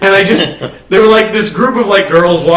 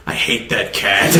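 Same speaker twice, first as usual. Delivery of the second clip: deadpan sincere confession; 11 semitones lower, 8 dB quieter than usual. Retold or imitated. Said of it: "with all the honesty I've ever had".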